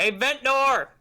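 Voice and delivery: high, loud call across camp; yelling. Acted. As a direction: call out to a friend. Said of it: "I'm gonna yell to Holden".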